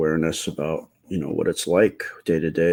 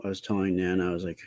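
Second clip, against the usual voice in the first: ordinary delivery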